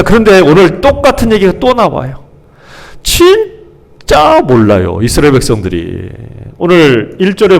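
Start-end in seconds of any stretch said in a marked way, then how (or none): 3.0-5.0 exasperated disbelief. Acted. Preacher despairs at the Israelites.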